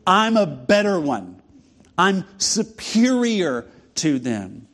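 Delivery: mock arrogant brag, slow at the end; boastful. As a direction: puff yourself up like a smug rival apostle. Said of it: "I'm gonna talk like this".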